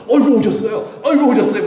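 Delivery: high, loud, gushing mock welcome; 호들갑스러운 영접. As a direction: fawn over an important guest, half laughing. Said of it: "영접이라는 말은 뭐예요?". (높은 목소리로 호들갑 떨며 반기는 시늉)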